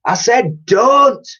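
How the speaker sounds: high, loud, slow insistent warning; stern repeat. A narrator's reading. Old cleaner stopping the girl, loud slow warning. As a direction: stress every word, insisting. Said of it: "repeated Dotty".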